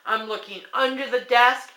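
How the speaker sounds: ordinary talk, nothing marked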